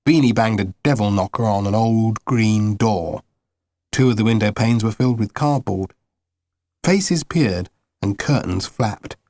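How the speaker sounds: ordinary delivery, nothing marked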